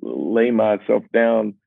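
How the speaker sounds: half-laughing frustration, voice climbing high; mock-desperate, voice way up; exasperated. Real, not acted. Exasperated truth behind sweet song.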